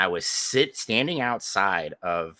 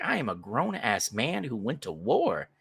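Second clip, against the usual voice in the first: higher-pitched, swooping indignant retort; mock indignation; 5 semitones higher than usual. Veteran guard answering being called boy, mock indignation. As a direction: protest with wounded pride, hammed up.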